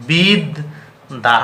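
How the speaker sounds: বিদ then দা, split to show the doubled d; syllable by syllable. Shown as a demonstration. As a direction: break বিদ্যান into বিদ and দা. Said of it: "এমনভাবে লিখতে হবে, বলতে হবে". (ভেঙে ভেঙে উচ্চারণ)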